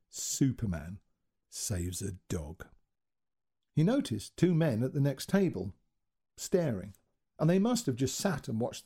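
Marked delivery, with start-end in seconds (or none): 0.1-2.7 wry self-mockery. A narrator's reading.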